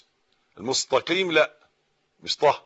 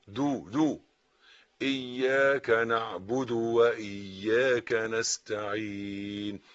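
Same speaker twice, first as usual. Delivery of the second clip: ordinary delivery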